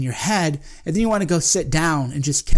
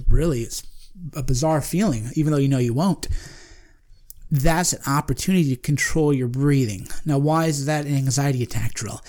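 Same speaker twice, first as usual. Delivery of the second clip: normal talking voice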